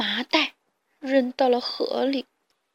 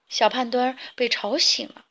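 usual speaking voice

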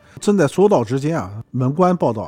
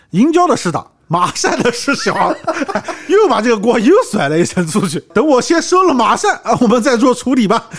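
laughing; 7 semitones higher, 4 dB louder than usual. Acted. Voicing Randeng, laughing.

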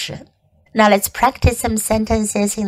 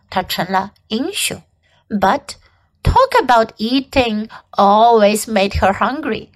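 ordinary delivery

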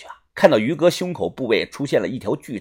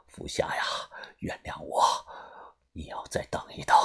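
entranced whisper, lower and softer; whispering to himself; 12 semitones lower, 13 dB quieter than usual. A narrator's reading. Old Fu whispers an apology to his dead daughter, entranced. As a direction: breathe the words to yourself, lost. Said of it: "自言自语的说道".

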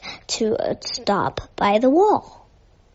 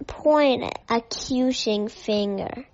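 ordinary delivery

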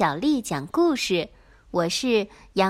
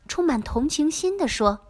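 plain reading voice

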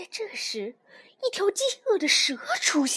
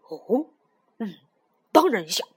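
pondering snapping turtle; 5 semitones lower, 6 dB louder than usual. A narrator's reading. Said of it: "鳄龟想了想说".

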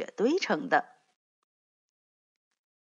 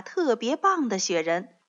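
ordinary delivery, nothing marked

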